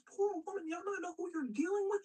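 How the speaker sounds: very high, indignant outburst; mock incredulous. Octavius scolding soldiers for letting her go, mock incredulous. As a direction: squeal it back in exaggerated disbelief.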